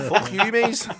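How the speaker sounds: laughing, high Scouse jeer; half-laughing Scouse swear at pals; mock Scouse swearing. Friends egging him on to swear in a Scouse accent. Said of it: "Swear at one of your cats or something in a Scouse accent".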